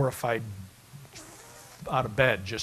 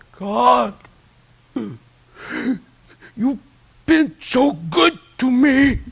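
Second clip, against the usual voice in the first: much higher, louder, slow tearful cry to God; retold tearful cry; 11 semitones higher, 9 dB louder, much slower than usual. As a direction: cry it out like a broken man at the altar. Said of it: "and he was crying".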